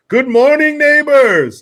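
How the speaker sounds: high, loud sing-song good morning; greeting the warehouse crew, loud and cheerful; loud cheery greeting. Retold or imitated. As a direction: boom it out as you walk in. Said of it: "walk in the door and go".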